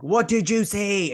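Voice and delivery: high, drawn-out imitation of a demanding question; exaggerated retold demand, slow and high; insistent mock demand. Acted repeat of his urgent question.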